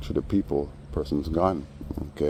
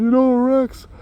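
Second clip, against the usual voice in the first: very high falsetto whine, much louder; mock fake crying; 8 dB louder, slower than usual. Teller mimics the man acting like he's crying, hammed-up falsetto. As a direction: ham up a fake weepy lament. Mocking the guy's reply.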